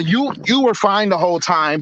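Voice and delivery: usual speaking voice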